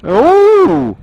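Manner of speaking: high, cheeky ooh. Real, not acted. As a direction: react like a saucy joke. Cheeky innuendo reaction.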